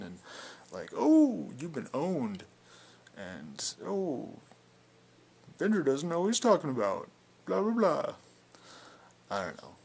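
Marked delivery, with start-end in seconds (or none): 0.9-2.4 high, loud sing-song mock "oh"; mocking jabs. Speaker mimics a commenter getting his little jabs in, mockingly. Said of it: "getting his little zings in there". 3.8-8.1 loud, high-pitched mimicry of a heckler; mocking jabs. Speaker mimics a commenter's little jabs, loud mocking tone. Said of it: "his little jabs in".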